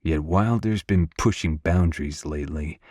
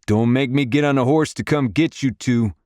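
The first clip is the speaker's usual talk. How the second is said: put-on higher-pitched call to the boys; mild shout; 6 semitones higher, 3 dB louder than usual. A narrator's reading. Father warning his sons off the horses, mild shout. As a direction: call out a warning, raised but contained. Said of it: "then shout".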